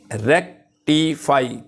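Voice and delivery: Rectify slowed into three parts, रेक, टि, फाई; syllable by syllable. Shown as a demonstration. Teacher counting out three syllables, rectify said slowly in pieces. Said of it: "इसमें three syllables हैं".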